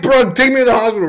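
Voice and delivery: high-pitched, rushed plea; panicked yelp without full volume, imitated; mock scream. Begging dad for a hospital ride, high mock scream. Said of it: "But I'm not screaming like that".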